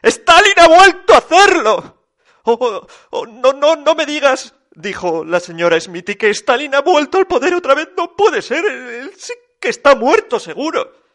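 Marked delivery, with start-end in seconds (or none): 0.0-1.9 high, loud melodramatic alarm; outraged shout. A narrator's reading. Rasputín announcing Stalin's latest misdeed, outraged shout. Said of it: "gritó Rasputín". 2.5-4.5 high, stammering dread; alarmed disbelief. A narrator's reading. Señora Smithy reacting to news of Stalin, alarmed. 6.1-11.0 high-pitched flustered denial; alarmed disbelief. A narrator's reading. Señora Smithy fearing Stalin is back in power, panicked.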